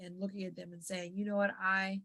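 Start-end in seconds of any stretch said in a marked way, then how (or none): 1.1-2.0 slow, deliberate. Shown as a demonstration. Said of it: "speaking slowly, pausing".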